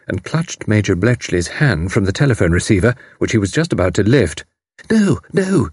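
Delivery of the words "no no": high, urgent panted protest; panting. A narrator's reading. Frantic mother stops Major telephoning, panting. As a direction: pant it out high in alarm. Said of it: "she panted".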